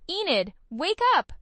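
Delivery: high, loud urgent call to wake her. A narrator's reading. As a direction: shake her awake with your voice. Jordan urgently waking narrator.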